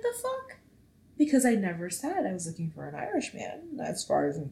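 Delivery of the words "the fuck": high squeaky mock disbelief; shocked disbelief. Friend's news leaves her stunned, squeaky disbelief. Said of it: "I'm like".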